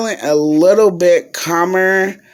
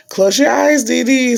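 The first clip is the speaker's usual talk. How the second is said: much higher-pitched retold nurse voice; nurse instructing a patient; 9 semitones higher than usual. Nurse telling Dee Dee to close her eyes, in a high-pitched mock voice.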